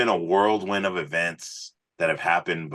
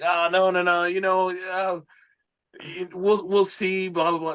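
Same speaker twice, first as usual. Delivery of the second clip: much higher, sheepish mock dodge; shy brush-off; 12 semitones higher than usual. Singer shyly dodging the producer's request, high sheepish voice. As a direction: imitate yourself squirming out of it, pitched way up. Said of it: "naturally I got shy".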